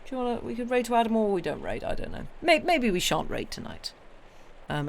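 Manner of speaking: ordinary delivery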